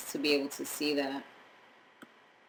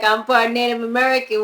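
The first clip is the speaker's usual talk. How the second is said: mock claim; 7 semitones higher, 10 dB louder than usual. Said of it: "I can't be like".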